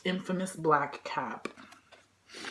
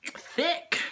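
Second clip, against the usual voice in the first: suggestive; 7 semitones higher than usual. Real, not acted. Suggestive emphasis.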